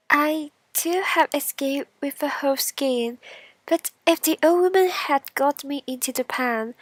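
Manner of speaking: loud, high bean telling of escape; bean character. Acted. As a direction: protest the cruel fate as the bean. Bean voiced in fairy tale.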